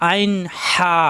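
আইন হা pressed from the middle of the throat; squeezed throat. Shown as a demonstration. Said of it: "গলা চিপে উচ্চারিত হয়".

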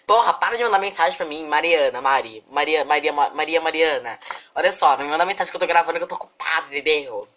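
loud, fast scolding; irritated telling-off; annoyed scolding. Real, not acted. Scolding friend texting her.

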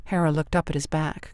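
plain reading voice

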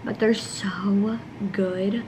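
slow whispered so good. Real, not acted. Girl tasting fresh cookies, slow whisper. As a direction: breathe it slowly over the cookies.

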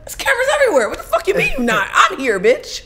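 high-pitched mock outrage; indignant retort. Co-star confronts her over filming, indignant. Acting out Jocelyn's angry confrontation.

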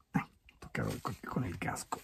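whispered aside. Real, not acted. Helmet bumps in narrow cave.

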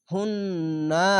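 হুন্না with the ন held in a long nasal hum; obligatory ghunna. Shown as a demonstration. Quran teacher showing wajib ghunna, long nasal hum in হুন্না. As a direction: draw out the humming double n in হুন্না. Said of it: "এখানে ওয়াজিব গুন্না".

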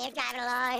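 normal talking voice

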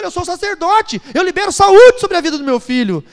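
high, loud, shouted sermon climax; fiery preaching. Acted. Pastor urges husbands to bless their homes, shouting fervently. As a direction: thunder it to the congregation.

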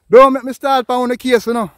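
loud, fed-up cry; exasperated outburst. Real, not acted.

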